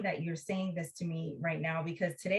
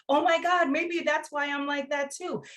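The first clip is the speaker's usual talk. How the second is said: high-pitched, louder "oh my God" of surprise; sudden realization; 8 semitones higher, 3 dB louder than usual. Retold or imitated. Hearing her sister, she realizes it about herself in surprise. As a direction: realize it about yourself with a jolt. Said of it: "I was like".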